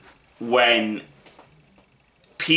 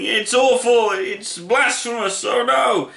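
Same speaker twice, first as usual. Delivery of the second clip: high, mock-outraged whine; mocking whiny voice; 10 semitones higher, slower than usual. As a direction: parody an offended critic. Mocking offended YouTube critics.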